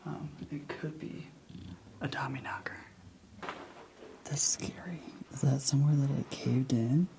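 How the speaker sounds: hushed unvoiced whisper in the dark. Real, not acted. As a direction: whisper it so nothing hears you. Whispering in a creepy mine.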